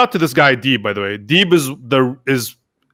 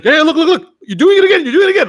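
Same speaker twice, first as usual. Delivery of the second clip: high-pitched, loud mock pointing-out; imitating excited friend; 13 semitones higher, 5 dB louder, faster than usual. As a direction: crow it high, like catching someone in the act. Imitates friend gleefully catching him out.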